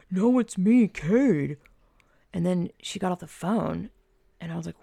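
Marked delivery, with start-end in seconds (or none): 0.1-1.6 loud, higher put-on voice; badly disguised voice. Storyteller mimics friend posing as Cade, badly disguised voice. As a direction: imitate someone badly faking another identity. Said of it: "it sounds like Katie going".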